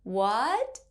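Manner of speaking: "What?" gliding sharply upward; rising tone. Shown as a demonstration. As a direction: lift "What?" like a questioning second tone. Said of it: "It's similar to when we say".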